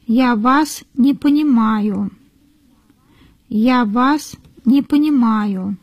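usual speaking voice

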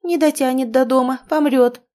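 alarmed. A narrator's reading. The hostess warns the friends against moving him, alarmed. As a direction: warn them off in a fluster. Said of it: "всполошилась хозяйка".

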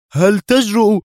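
high, loud outraged challenge; indignant cry. A narrator's reading. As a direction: shout back in disbelief. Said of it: "يهتف معترضا ومستنكرا". (صيحة استنكار عالية)

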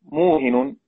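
"موهن" said as muhinun, with tanween; teacher presenting "موهن" as muhinun; announcing the word. Shown as a demonstration. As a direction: give "موهن" its full ending, muhinun. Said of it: "الكلمة الثانية".

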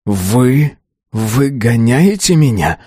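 slow, hurt challenge; quick retort. A narrator's reading. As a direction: throw the question back, drawing it out. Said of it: "тут же парировал парень". (возражая, протяжно)

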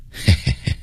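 soft, low heh-heh-heh; sly chuckle. Acted. Monk about to tell the prince he is wrong, chuckling. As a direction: chuckle knowingly under your breath.

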